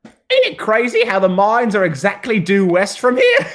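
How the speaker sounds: a bandit blurting out the secret; lightly voiced gossiping bandit. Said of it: "one goes".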